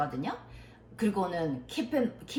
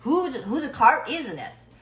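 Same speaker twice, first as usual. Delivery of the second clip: high, loud, slow demand; teacher calling out; 6 semitones higher, 7 dB louder, much slower than usual. Retold or imitated. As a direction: imitate a teacher hunting for the driver. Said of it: "이렇게 얘기를 했어요". (차 주인을 찾는 선생님처럼 높고 크게)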